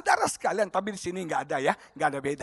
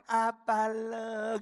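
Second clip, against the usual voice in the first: very slow, drawn-out Javanese delivery; Javanese accent; 5 dB quieter, much slower than usual. Acted. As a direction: stretch each syllable softly, Javanese style. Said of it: "Kalau orang Jawa kan". (logat Jawa lambat mendayu)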